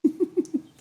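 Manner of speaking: short high chuckle. Real, not acted. Game master amused by starving children, chuckling.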